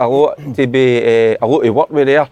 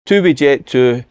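ordinary talk

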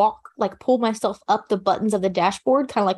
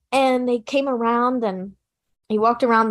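ordinary talk

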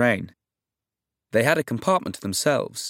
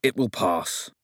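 hoarse; slower than usual. Acted. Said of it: "answered hoarsely between coughs".

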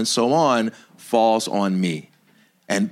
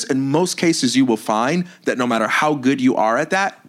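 usual speaking voice

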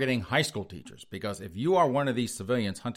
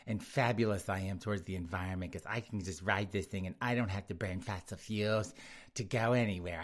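soft, self-satisfied mock voice; smug liberal cyclist; 6 dB quieter than usual. Mocking smug liberals on rental bikes, softly smug. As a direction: preen softly, pleased with yourself. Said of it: "They're the only ones going".

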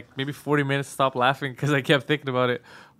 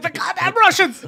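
very high, loud, laughing cry; mock panic; 5 dB louder than usual. Governor fleeing the building scared, mock panicked cry. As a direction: shriek it like a hammed-up alarm. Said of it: "he's like".

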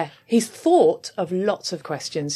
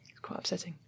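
breathy whispered aside; 18 dB quieter than usual. Retold or imitated. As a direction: whisper it, barely audible. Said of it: "what I heard from the corner was".

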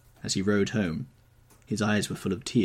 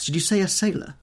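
startled; 11 semitones higher, 5 dB louder than usual. Acted. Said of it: "he cried out".

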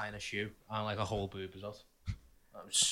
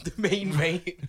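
laughing; 4 semitones higher, much slower than usual. Real, not acted. Laughing repetition.

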